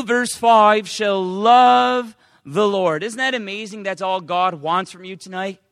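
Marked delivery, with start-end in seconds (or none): 0.9-3.0 loud, solemn reading of holy words; loud preacher reading scripture. Acted. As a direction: proclaim the verse to the congregation. Reading scripture aloud in sermon.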